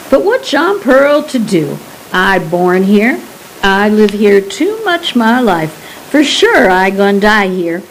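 lightly voiced Cajun old man, resigned about his home; a touch of Cajun lilt, stubborn and proud; old Cajun recluse. Old Pearl explains to the guide why he stays, lightly Cajun.

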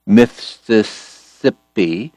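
"Mississippi" drawn out, every S crisp; slow careful enunciation. Acted. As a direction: sound out "Mississippi" very slowly, hitting each S. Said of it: "out saying".

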